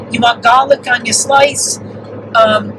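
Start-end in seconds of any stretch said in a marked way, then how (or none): 0.1-1.8 imitated New York accent with rounded-off words. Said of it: "with the rounding off the words".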